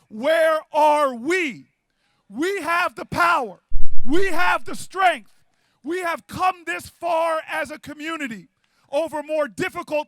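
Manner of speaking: loud, stretched-out punch lines; preaching at full force. Real, not acted. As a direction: preach to the crowd, hammering each refrain. Rallies the crowd like a preacher.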